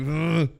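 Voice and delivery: loud fed-up groan; weary groan. Retold or imitated. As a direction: groan like a worn-out office worker. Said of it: "dude's over there just like".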